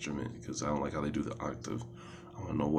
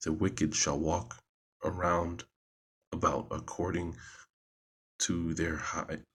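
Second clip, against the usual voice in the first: scripture reading. Acted. Reading a psalm aloud to listeners, solemn and measured. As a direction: read it out like scripture in church.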